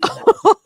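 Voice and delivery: short, high, loud laugh; delighted laugh. Acted. Host hearing of a fan, delighted laugh. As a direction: burst out laughing with glee.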